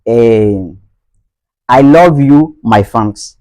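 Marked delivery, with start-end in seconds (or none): 1.7-3.4 LOVE as "lawv" in a Nigerian accent. Shown as a demonstration. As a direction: round the vowel of love to "aw", Nigerian style. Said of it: "Ça, c'est du côté, eh, nigérian".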